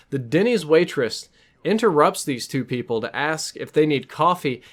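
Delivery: ordinary talk, nothing marked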